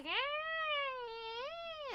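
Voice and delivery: high squeaky cat cry, mimicking her cat; imitated cat yowl. Owner mimicking her cat hating the wipes, high squeaky yowl. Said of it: "He's like".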